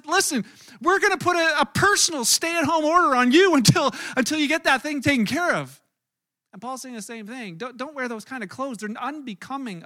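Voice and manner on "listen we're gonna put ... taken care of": high, loud mock-stern order; hammed-up bossy friend laying down the law. Friend ordering a stay-at-home, loud mock sternness. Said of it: "Like somebody says".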